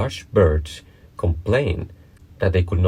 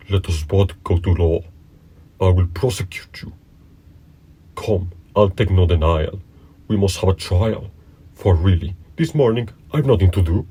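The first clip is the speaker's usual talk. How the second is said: a hint of a pushy accuser; lightly voiced bully Fury, insistent. A narrator's reading. Fury challenges a mouse to a trial, lightly insistent. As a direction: press the demand firmly.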